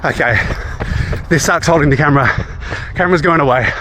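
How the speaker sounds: breathing hard mid-run; while running. Real, not acted. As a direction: talk while jogging hard. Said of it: "I'll try running, holding a camera".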